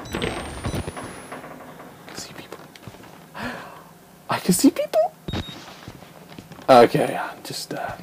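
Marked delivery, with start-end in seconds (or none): 0.1-2.6 hushed, voiceless whisper. Pretend. Player hiding from people, whispering. Repeats line in different ways. 4.3-5.1 much higher, panicky squeak. Pretend. Player spotting enemies, high frightened squeak. Repeats line in different ways.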